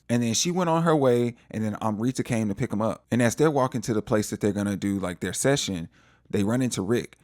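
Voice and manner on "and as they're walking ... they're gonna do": normal talking voice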